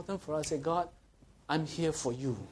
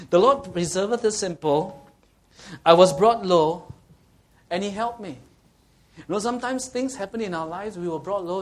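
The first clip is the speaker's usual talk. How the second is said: forceful preaching; 4 semitones higher, 9 dB louder than usual. Acted. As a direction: proclaim the verse to the whole hall.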